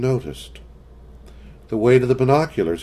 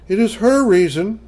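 put-on flat child voice; 11 semitones higher than usual. A narrator's reading. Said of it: "Kathy said flatly".

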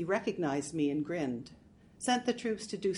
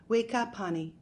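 usual speaking voice

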